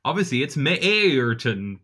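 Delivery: Meerten drawn out slowly as MEER-ten with a long ee vowel; mock Dutch pronunciation. Shown as a demonstration. Co-host teasing with a mock Dutch version of the name, slow. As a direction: stretch Meerten slowly, like a know-all Dutch speaker. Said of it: "tell me how the Dutch speak".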